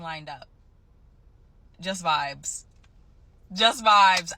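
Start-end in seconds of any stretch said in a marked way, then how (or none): 3.5-4.3 louder, elated repeat; excited. Real, not acted. Nurse quitting with nothing lined up, excited. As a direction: punch it out with excitement. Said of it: "You sound so excited".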